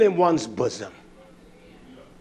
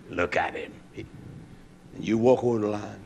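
low, soft and slow; a hushed aside; hushed narration; 9 semitones lower, 9 dB quieter than usual. Retold or imitated. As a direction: draw the listener in quietly. Narrating the standoff quietly.

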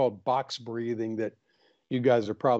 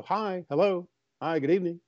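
polite phone greeting; 4 semitones higher than usual. Retold or imitated. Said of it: "shut that anger off and just go".

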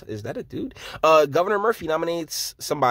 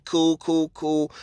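ordinary talk

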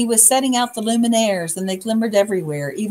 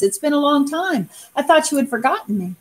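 touched; 7 semitones higher than usual. A narrator's reading. Armadillo moved to see friends.

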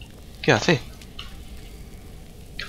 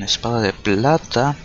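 ordinary talk, nothing marked

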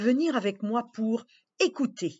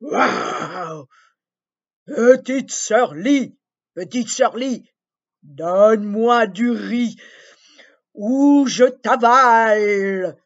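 loud, slow booming dragon roar; 8 dB louder, slower than usual. Acted. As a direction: threaten her like a hungry river dragon. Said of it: "bondit en rugissant". (rugissement de dragon menaçant)

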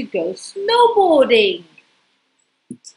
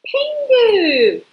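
excited name reveal; 11 semitones higher, 4 dB louder than usual. Shown as a demonstration. Introducing the story's hero.

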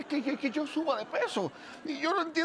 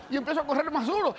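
ordinary talk, nothing marked

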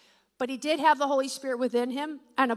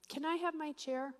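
ordinary delivery

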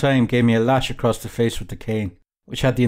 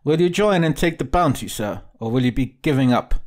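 officer's higher-pitched denunciation, pressing on point by point; accusing captain, angry; 4 semitones higher than usual. Acted. Captain confronting a suspected deserter with his lies, accusing. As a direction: voice a recruiting captain exposing an impostor.

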